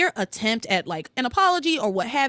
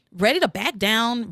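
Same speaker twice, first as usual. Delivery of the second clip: normal talking voice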